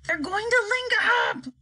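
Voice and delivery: high, slow excited president shout; the president cries out at the screen. A narrator's reading. President shouting at the TV screen, high and excited. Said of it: "shouted the president".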